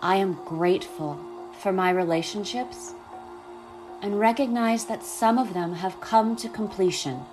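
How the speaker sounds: calm, unhurried guiding voice; guided meditation. Acted.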